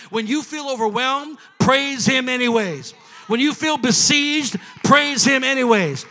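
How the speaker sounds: loud, rising preacher's cry; preaching at full force. Acted. Pastor urging the church to praise, loud preaching. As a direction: build the sermon to a shout.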